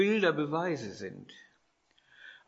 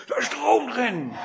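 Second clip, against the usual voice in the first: raised, louder mock-horrified cry; 6 semitones higher, 5 dB louder than usual. Startled woman warns Professor Gitt about the socket, mock horrified. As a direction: blurt it out like an alarmed woman. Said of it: "die Frau sagt entsetzt".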